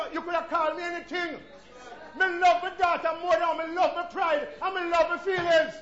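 high, loud, slow sermon build; full force preaching. Acted. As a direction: preach her desperation out.

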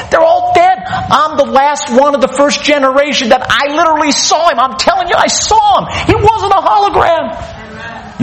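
acted insistence, pitched high and climbing; fired up. Preacher as John defending what he saw, fired up. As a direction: insist urgently as the last living eyewitness. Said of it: "And so he's fired up".

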